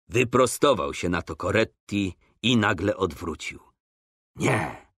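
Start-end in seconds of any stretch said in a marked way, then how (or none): none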